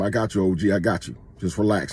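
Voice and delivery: low, soft, soothing murmur; calm him down gently; quietly retold reassurance, pitched low; reassuring. Retold reassuring of a rescued man.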